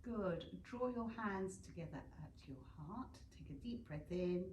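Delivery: calm, unhurried cues for each breath; guided meditation. Acted. Yoga teacher guiding students through breathing, calm meditation voice. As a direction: talk the class through breathing and alignment.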